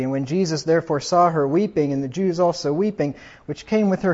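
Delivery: scripture reading. Acted. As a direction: proclaim the verses to the congregation. Reads scripture aloud.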